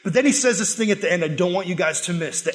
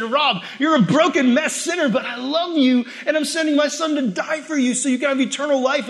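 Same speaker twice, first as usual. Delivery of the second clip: impassioned; 6 semitones higher, 4 dB louder than usual. Acted. Voicing Jesus' loving words.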